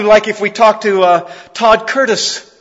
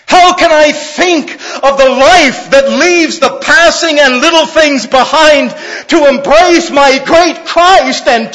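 fervent preaching; 7 semitones higher, 5 dB louder than usual. Acted. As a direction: build to a shouted climax. Preaching climax.

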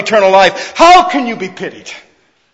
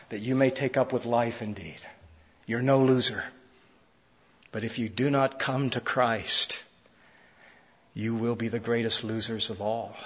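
much softer, lower pastoral plea; quiet altar call; 6 semitones lower, 8 dB quieter than usual. Acted. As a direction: draw the listener in gently.

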